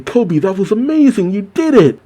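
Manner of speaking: high-pitched imitation of tearful joy; mock happy crying. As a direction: act overwhelmed by a friend's feat. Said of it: "and he's crying".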